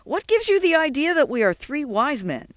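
high-pitched incredulous challenge; scoff, voice soaring in disbelief; scornful disbelief. A narrator's reading. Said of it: "Sol snorted".

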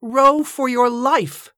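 slow, high urgent call; drawn-out warning shout. A narrator's reading. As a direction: stretch every word out across the river. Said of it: "Joshua shouted again".

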